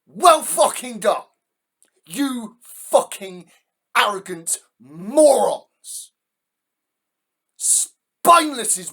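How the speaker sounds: raging at the supporters, slow loud fury; furious shout. Real, not acted. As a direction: bellow the insults slowly in real rage.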